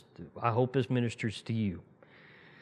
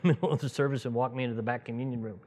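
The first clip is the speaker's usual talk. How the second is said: normal talking voice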